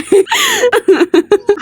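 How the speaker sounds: helpless high-pitched laugh; high laughter. Real, not acted. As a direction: burst out laughing at your own drawing. Laughs at her drawing.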